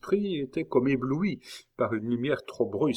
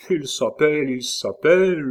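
loud, higher mocking repetition; laughing mockery; 6 semitones higher, 7 dB louder than usual. A narrator's reading. Innkeeper laughs at André's words, mimicking him. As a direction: echo him twice, loud and derisive. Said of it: "se mit à rire".

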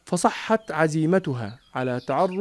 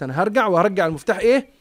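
quick resolve; 2 semitones higher, much faster than usual. Acted. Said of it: "خلاص، قالت".

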